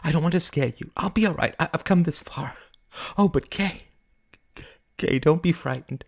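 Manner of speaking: high, earnest, stumbling plea; anxious high-pitched begging. Acted. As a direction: reassure a friend pleadingly. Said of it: "she begged".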